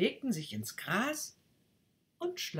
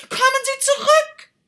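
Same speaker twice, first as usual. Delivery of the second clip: even louder, much higher desperate yell; louder shout; 17 dB louder than usual. Acted. Rocket yells again after the duck, louder still. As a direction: yell with everything you have. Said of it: "schrie die Rakete".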